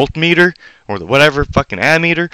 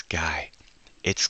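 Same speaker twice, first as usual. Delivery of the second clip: whisper; 8 semitones lower, 14 dB quieter than usual. Real, not acted. Tag to listener.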